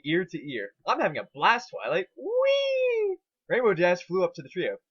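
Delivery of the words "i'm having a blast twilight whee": gleeful squeal. Acted. Pinkie gleefully skating.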